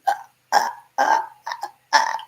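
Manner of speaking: breathless choking rasp, no voice; strangled gasps for breath. Retold or imitated. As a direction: imitate a man gasping for air. Imitating choking sounds.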